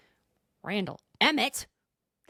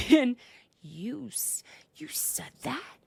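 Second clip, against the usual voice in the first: stunned disbelief; 4 semitones higher, slower than usual. Acted. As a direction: barely get it out, ending high. Acting Heather's stunned reaction.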